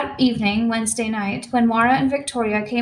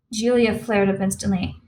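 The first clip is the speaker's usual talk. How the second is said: ordinary delivery, nothing marked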